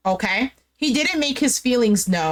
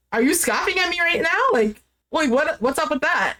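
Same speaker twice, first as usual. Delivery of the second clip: higher, challenging retold question; mock incredulous; 5 semitones higher than usual. Man calling out her scoff, mock incredulous. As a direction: play him taken aback, pitch rising.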